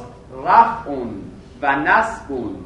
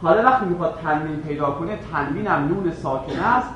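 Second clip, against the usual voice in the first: ordinary talk, nothing marked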